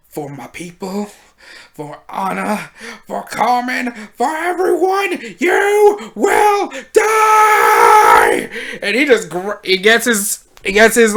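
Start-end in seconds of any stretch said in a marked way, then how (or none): 0.1-8.5 furious battle cry. Retold or imitated. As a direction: scream vengeance as he charges. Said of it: "my man's like".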